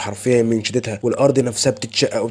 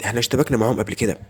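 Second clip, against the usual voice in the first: ordinary delivery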